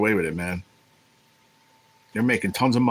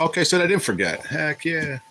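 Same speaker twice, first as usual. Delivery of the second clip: relieved excitement; 7 semitones higher than usual. Real, not acted. Streamer realizing he didn't miss the birthday, relieved excitement. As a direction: pitch jumps higher, glad and relieved.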